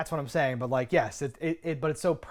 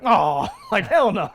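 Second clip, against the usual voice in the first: louder laughing mock Emerson; imitating Emerson; 5 semitones higher, 6 dB louder, slower than usual. Retelling Emerson's line, laughing mock imitation. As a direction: quote it with a laugh. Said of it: "he says it the same way".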